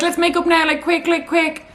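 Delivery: high, urgent, rushed pleading; impatient pleading. Retold or imitated. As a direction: beg to make up right now. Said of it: "I'm like".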